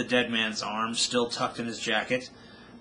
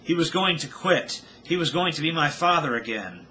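anguished cry; 6 semitones higher, 4 dB louder than usual. A narrator's reading. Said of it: "she screamed".

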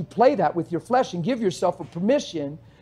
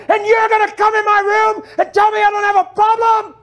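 much higher, loud furious shouting; screaming at God; 11 semitones higher, 5 dB louder than usual. Retold or imitated. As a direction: rage back at God with full force. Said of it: "he was screaming at God".

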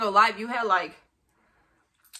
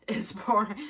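ordinary talk, nothing marked

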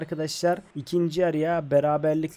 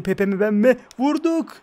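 high loud cry on the shot; excited shout; 7 semitones higher, 6 dB louder than usual. Real, not acted. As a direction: go for the header, shouting. Header chance, shouting.

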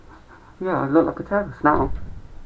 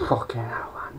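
whispered, out-of-breath curse; breathless whisper; 11 dB quieter than usual. Retold or imitated. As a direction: gasp it out with no voice. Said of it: "I'd be like".

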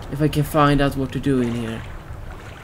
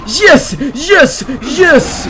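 loud, much higher cheering; triumphant yes; 9 semitones higher, 6 dB louder, much slower than usual. Real, not acted. Gamer lands the jump, loud high cheering. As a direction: cheer each yes like a win.

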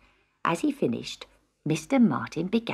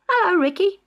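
put-on cheery boy greeting; 12 semitones higher, 4 dB louder than usual. A narrator's reading. John greeting friend.

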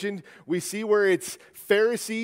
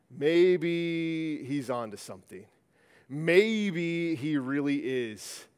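slow, drawn-out "maybe" of dawning wonder; imagined onlooker slowly reconsidering; dawning realisation; much slower than usual. Pretend. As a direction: stretch it out as if just working it out.

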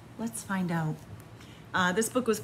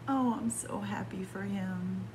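soft unvoiced whisper; happy whisper; 6 dB quieter than usual. Real, not acted. Reading to kids, the reader whispers her joy at the ending. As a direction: hush it, full of relief.

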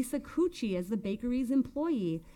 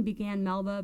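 ordinary delivery, nothing marked

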